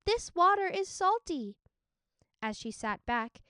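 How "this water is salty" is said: high, loud disgusted outburst; shocked at the salty taste; surprised exclaim. Acted. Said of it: "Minli exclaimed".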